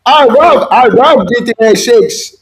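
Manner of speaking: high-pitched, hammed-up Korean-accented dictator; mock leader proclaiming his love; Kim Jong-un. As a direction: declare it in a caricatured high voice. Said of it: "Kim Jong-un is like".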